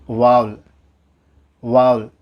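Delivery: வாவல் squeezed to one syllable, VAUL; correct vowel. Shown as a demonstration. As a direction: say வாவல் like owl with a V. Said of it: "வௌவல் அப்படின்னு நம்ம pronounce பண்ணக்கூடாது".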